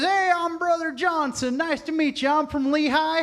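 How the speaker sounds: high, bright-eyed church greeter; high, friendly Brother Johnson introducing himself; cheery ward member. Retold or imitated. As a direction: greet a newcomer with eager welcome. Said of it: "He says".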